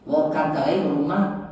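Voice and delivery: usual speaking voice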